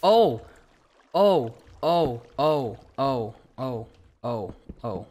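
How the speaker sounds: loud yelp dying away into small ohs; alarmed, quickly subsiding; startled oh-oh-oh. Real, not acted. Startled by trouble in game.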